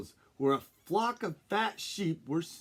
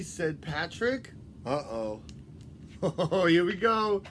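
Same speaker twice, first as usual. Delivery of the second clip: ordinary delivery, nothing marked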